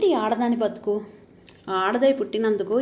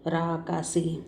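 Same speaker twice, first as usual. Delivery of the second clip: softer and lower, teasing; tender tease; 4 semitones lower, 5 dB quieter, much slower than usual. Acted. Husband teases his wife fondly in bed, soft. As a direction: murmur it fondly.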